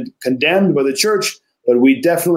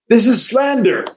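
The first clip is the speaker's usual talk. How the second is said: raised, heated protest; heated outcry; 6 semitones higher than usual. Retold or imitated. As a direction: cry it out in hot protest. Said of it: "cried out heatedly".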